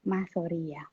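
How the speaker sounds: put-on soft tender child's sorry; quiet sweet apology; gentle apology. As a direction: say it softly while hugging mum. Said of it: "Dia peluk saya".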